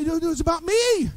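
high, loud mock whine of a selfish customer; mock self-centred. Preacher mocking a selfish customer at Burger King, high and loud. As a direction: act out a customer who thinks it's all about them.

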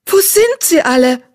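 high desperate asking. Acted. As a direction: plead for an answer. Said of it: "fragte Eichhornpfote verzweifelt".

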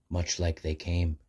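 plain reading voice

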